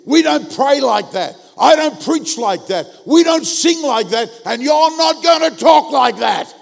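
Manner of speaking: loud, high verbal attack building to a shout; frighteningly forceful retold rant. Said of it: "I went on the attack".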